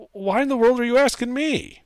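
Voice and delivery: high indignant half-orc. Acted. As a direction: squeak out a baffled brute.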